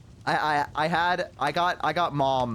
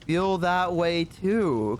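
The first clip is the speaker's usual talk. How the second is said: very slow, stretched-out agreement; hesitant, drawn-out pretend sincerity; scared fake agreement; 3 semitones higher, much slower than usual. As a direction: agree reluctantly, dragging each word. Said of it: "like, a little scared now".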